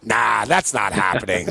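low, flat brush-off; dismissive. Pretend. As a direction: wave it away like nothing. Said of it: "I'd be like".

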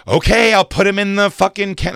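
loud, high-pitched mock compliance; exasperated retort. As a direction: give in loudly and angrily, imitating her. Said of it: "she probably was like".

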